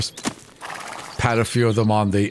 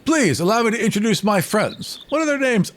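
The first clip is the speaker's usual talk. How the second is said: higher, showy bard voice; grand bard introduction; 8 semitones higher than usual. Acted. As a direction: present your friends with a flourish.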